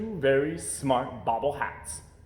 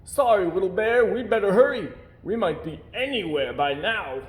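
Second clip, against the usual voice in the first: higher and louder, hurrying sailor; apologetic sailor; 7 semitones higher, 5 dB louder than usual. A narrator's reading. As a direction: urge the bear on. Sailor voiced, apologetic and hurried.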